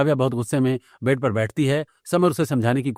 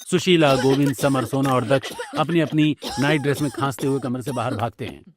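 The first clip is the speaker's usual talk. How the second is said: normal talking voice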